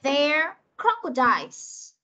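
very slow "There crocodiles", every sound clear; slow model sentence. Shown as a demonstration. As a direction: model it slowly for young learners. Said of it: "Ok, cô sẽ đọc này".

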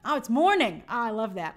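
ordinary delivery

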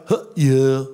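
a dopey [name] mumble; Ringo impression. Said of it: "And then Ringo, he was like".

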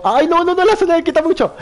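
high, loud alarmed cry; panicked gamer yelling; excited panic. Real, not acted. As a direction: yelp as the zone hurts. Panic in battle royale fight.

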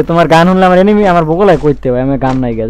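usual speaking voice

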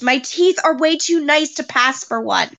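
ordinary talk, nothing marked

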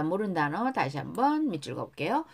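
usual speaking voice

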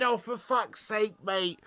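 ordinary delivery

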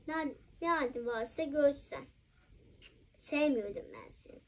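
weary quiet scolding. Pretend. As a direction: scold under your breath, worn out. Scolding goes soft and low.

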